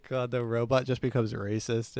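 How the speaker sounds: usual speaking voice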